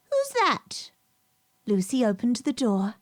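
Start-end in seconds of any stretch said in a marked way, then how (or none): 0.1-0.9 very high, startled little voice; frightened call. Acted. Hedgehog startled by Lucy's knock calls out high. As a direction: call out as if caught off guard. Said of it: "A little frightened voice called out".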